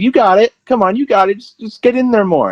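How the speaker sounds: high-pitched put-on cheerleading; mock encouragement. Storyteller as cop filming his scared partner, mock encouragement.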